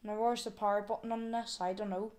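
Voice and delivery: ordinary talk, nothing marked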